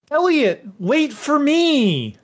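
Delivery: a little one crying out after her friend, slow and high; calling after Elliot. Acted. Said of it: "just kinda goes".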